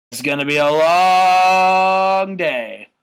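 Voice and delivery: slow, loud, drawn-out sigh of a line; long weary drawl. Acted. Stardust to the camera, slow and drawn out. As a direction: stretch every word out wearily.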